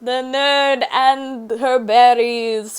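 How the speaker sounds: mock announcer. Grand slow title announcement.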